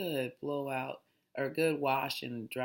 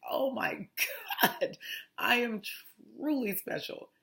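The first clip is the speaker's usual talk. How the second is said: mock crying; 9 semitones higher, 3 dB louder than usual. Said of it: "break out crying like".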